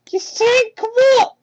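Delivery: put-on high kid voice, drawn-out curious question; little fox kid voice. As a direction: stretch out the question like a puzzled child. Young Tails asks, slow.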